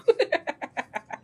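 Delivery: high, real giggling laugh. Real, not acted. Cook nearly biting her tongue, laughing at herself.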